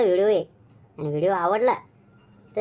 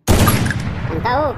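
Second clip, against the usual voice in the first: drawn-out challenge; 5 dB louder, much slower than usual. Acted. Wife rounds on husband.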